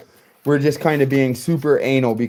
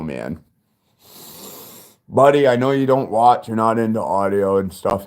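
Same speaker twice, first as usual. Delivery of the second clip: real tears held back, talking to his son; father breaking down with pride; getting emotional; 6 semitones lower than usual. Real, not acted. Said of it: "I'm gonna get emotional".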